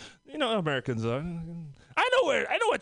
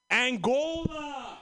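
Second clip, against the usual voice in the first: slow stretched higher Angola!; incredulous; 4 semitones higher, much slower than usual. Real, not acted. Mocking USA playing Angola, stretched disbelief.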